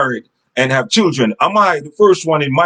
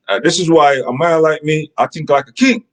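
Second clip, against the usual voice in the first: ordinary delivery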